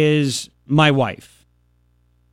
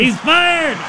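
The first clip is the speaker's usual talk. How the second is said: higher, punchy echo of the clip; echoing Trump; 9 semitones higher than usual. Retold or imitated. Host echoing the president's line, higher and punchy. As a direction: repeat the president's line with relish.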